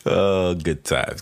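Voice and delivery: ordinary delivery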